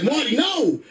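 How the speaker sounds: high, panicked warning yelp; panicked shout. Retold or imitated. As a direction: cry out to stop a child in a panic. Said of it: "and I panicked".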